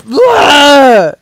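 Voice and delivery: loud drawn-out "blah" of frustration; exasperated bleating yell; frustrated yell. Real, not acted. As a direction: let out a wordless cry of exasperation. Frustrated noise after dying.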